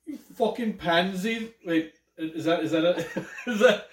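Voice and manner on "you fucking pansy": mock British insult in drawn-out, higher pitch; British accent. Imitating Princess Di cursing people off, in a British accent. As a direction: put on a cheeky British accent. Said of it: "is that a British accent?".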